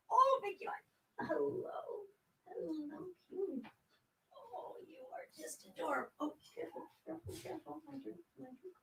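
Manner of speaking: cooing at a pet. Real, not acted. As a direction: coo softly over the little one. Cooing at a kitten on camera.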